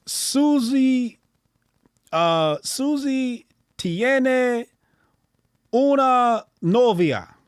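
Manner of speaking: high, slow app lesson voice; language app voice. Retold or imitated. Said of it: "at some point it was like".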